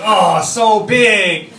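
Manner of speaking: loud, drawn-out mock awe; big slow exclamation of wonder; mock amazement. Kindergartner marvelling in the bathroom, loud mock awe. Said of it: "He's like".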